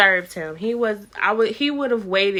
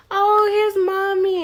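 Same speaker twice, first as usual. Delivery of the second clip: put-on baby talk; 11 semitones higher, 5 dB louder, slower than usual. Soothing her crying newborn.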